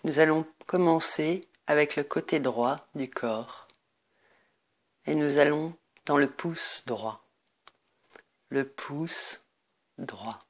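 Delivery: guided meditation. Acted. Leads a Nidra Yoga meditation.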